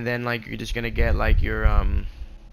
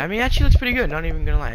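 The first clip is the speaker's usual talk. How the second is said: excited; 7 semitones higher, 6 dB louder, much faster than usual. Real, not acted. Admires own build.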